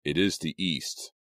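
slow, theatrical Shakespeare delivery; dramatic recitation. A narrator's reading. Boy clowning with Romeo's lines before tryouts, dramatic recitation. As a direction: declaim it grandly, stretching the words. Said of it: "Jake recited dramatically".

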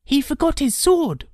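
put-on high, loud boyish voice; observant boy. A narrator's reading. Boy noticing the robber's dropped sword, pointing out. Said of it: "Arthur pointed out".

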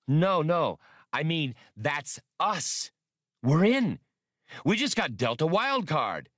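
high, loud, gleeful rush; gleeful whoop. Acted. Han explaining the plan to Atwari, gleeful. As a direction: bubble over with glee. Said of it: "He whooped".

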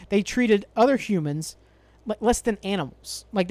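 normal talking voice

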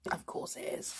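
near-whispered, confiding aside; hushed aside. Acted. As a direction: drop to a soft, knowing murmur.

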